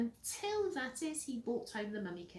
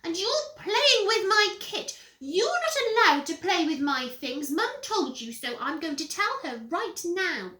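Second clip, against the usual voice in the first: high, loud whine; tattling little brother; 8 semitones higher, 10 dB louder than usual. Acted. Peter threatening to tell Mum, high tattling whine.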